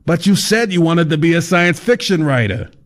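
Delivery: high, breezy know-it-all guide; fat man's voice. Acted. Fat man explaining to Kyle, high cheery voice. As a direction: lecture the writer like it's obvious.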